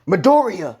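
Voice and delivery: high, loud cry of alarm; shocked shout. Acted. Principal seeing his hurt student, shocked shout. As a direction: call out the name in shock. Shocked Nezu cries the name.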